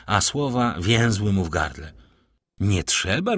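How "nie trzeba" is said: usual speaking voice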